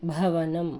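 భవనం with a breathy bh, said from the belly; aspirated bh. Shown as a demonstration. Teacher showing aspirate భ to pupils, strong from the belly. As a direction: push air on భ in భవనం. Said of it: "కొంచెం గట్టిగా నాభి లోపల నుండి చదవాలి".

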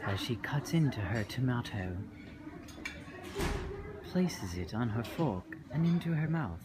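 low, soft documentary-style commentary; hushed mock narration. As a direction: narrate her breakfast quietly like secret observation. Mock narration of friend eating breakfast.

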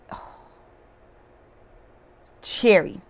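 ordinary delivery

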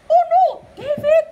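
loud, high cry of alarm; mother yelling as he runs; alarmed shout. Acted. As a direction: shriek after him. David runs off again.

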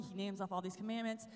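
ordinary talk

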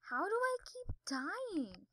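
high, drawn-out whine; stretched, rising complaint that drops off; whiny wail. Real, not acted. Player baffled at dying in the game, whiny wail.

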